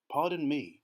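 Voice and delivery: ordinary delivery, nothing marked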